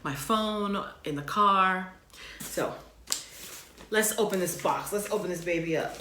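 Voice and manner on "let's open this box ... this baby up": ordinary talk, nothing marked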